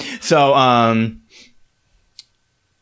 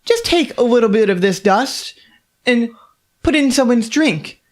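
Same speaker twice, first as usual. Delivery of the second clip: higher, bright pitch for a deadly dust; auctioneer sales pitch; 10 semitones higher than usual. Acted. Auctioneer cheerfully describing the deadly sneezing dust. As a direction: sell poison like a cheerful host. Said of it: "she goes".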